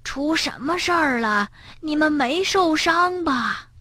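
put-on soft, high old granny's concern; worried. A narrator's reading. Granny asking whether the children are hurt, worried. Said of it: "担心地呼喊着".

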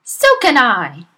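loud, curt snap; snapped. Acted. Headmistress snaps at the teacher, loud and curt. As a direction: snap it back hard. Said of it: "Miss Trunchbull snapped".

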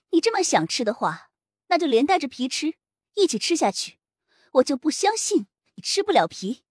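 icy, dismissive high voice; cold mother. Acted. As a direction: brush the child off coldly. Cold mother refusing child.

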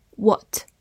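British "What" with a rounded o; British accent. Shown as a demonstration. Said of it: "美式发音".

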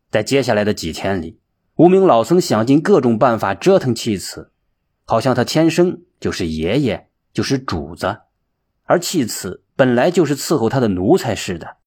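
usual speaking voice

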